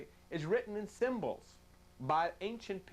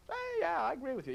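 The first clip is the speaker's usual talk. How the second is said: much higher, chatty reply; inner voice answering; 8 semitones higher than usual. Retold or imitated. Preacher acting the mind answering itself, high chatty reply. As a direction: answer yourself brightly.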